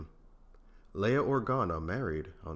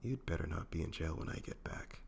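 softer, intimate teasing threat; whispered warning; 8 dB quieter than usual. A narrator's reading. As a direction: lean close and murmur it. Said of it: "She leaned in on him and whispered".

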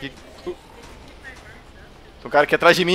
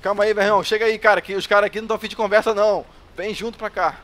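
urgent; 5 semitones higher, 6 dB louder, faster than usual. Real, not acted. Under threat, urging friend.

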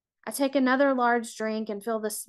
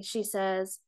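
plain reading voice

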